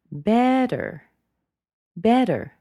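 "better" pitched high, then dropped suddenly; end-of-sentence drop on a high "better"; rise then sudden fall. Shown as a demonstration. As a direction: swoop "better" up and let it fall. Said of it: "it rose even higher and then dropped down suddenly".

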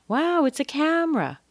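"Wow" jumps high, mock excited; gleeful high wow. Shown as a demonstration. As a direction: play up surprise at the camera. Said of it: "the most important word".

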